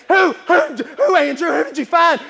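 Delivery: loud, high, urgent questions; eager Peter. Acted. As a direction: demand the name, breathless with curiosity. Peter answers Andrew.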